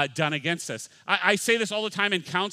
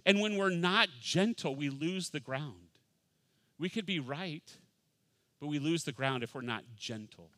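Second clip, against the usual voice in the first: ordinary talk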